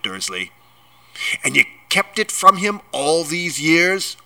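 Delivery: building from held-in anger to loud outrage; furious giant, building; mounting rage. A narrator's reading. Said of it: "his every syllable trembled with rage".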